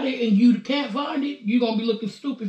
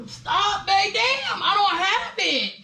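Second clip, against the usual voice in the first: high, drawn-out plea to stop; exasperated protest; 7 semitones higher, much slower than usual. Real, not acted. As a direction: whine it out while fending someone off. Fending off pocket search.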